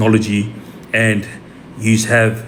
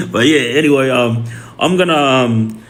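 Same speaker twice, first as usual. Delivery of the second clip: normal talking voice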